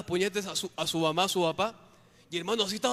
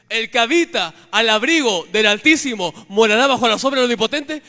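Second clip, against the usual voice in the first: shouted rebuke; 3 semitones higher, 13 dB louder than usual. Real, not acted. Preacher rebuking the demon in prayer, shouting the psalm. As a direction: hurl the verse at the demon.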